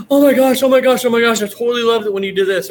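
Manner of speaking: high, loud gushing fangirl; fangirl squeal. Retold or imitated. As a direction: squeal like a starstruck fan. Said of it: "And you're like".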